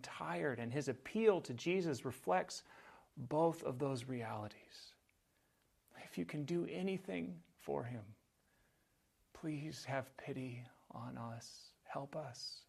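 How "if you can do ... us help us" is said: soft, whispered plea for help; a hushed, pleading father; desperate plea. Acted. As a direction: beg quietly like a worn-out father. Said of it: "Listen to that request".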